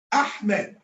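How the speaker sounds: "Ahmed" as Ah-med, H clearly sounded; breathy H in Ahmed. Shown as a demonstration. Teacher models the name for a student, breathy H in Ahmed. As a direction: say "Ahmed" with an audible H before the M. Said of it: "not Ahmad".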